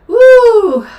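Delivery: sudden high, loud woo; a shrill celebratory woo at her own blunder; whooping cheer. Real, not acted. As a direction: let out a loud, high self-mocking whoop. Whoop after laughing at herself.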